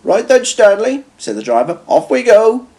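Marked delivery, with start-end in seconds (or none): 0.0-1.1 higher, drawn-out hearty call; rally the engine as its driver. A narrator's reading. Driver calls to Stanley. 1.9-2.7 high, hearty send-off; engine driver voice. A narrator's reading. Driver starts the journey.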